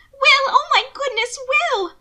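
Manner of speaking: high, loud, panicked calling of his name; alarmed cry. Acted. Mina crying out to fallen Will, high and panicked. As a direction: shriek his name in fright.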